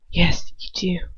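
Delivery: unvoiced, barely audible; low whisper. Lucius whispering to her, barely audible. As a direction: murmur it so she can barely hear. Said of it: "he mutters, so low".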